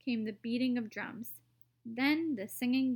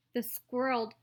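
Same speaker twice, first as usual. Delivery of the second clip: usual speaking voice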